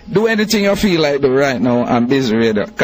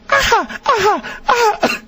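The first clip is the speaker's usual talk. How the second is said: squealing with laughter, high; real burst of high laughter; laughing aha. Real, not acted. Host cracks up laughing.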